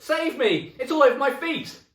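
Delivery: shrieked very high and loud; full panicked shriek; terrified scream. Acted. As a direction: scream for your life. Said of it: "screamed Missus Twit".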